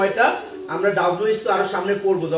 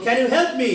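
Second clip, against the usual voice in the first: "can you help me?" lifted on "help me"; rising tune; 3 semitones higher, much slower than usual. Shown as a demonstration. Teacher drilling a yes-no question, rising "help me". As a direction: send "help me" upward. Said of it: "rising হবে".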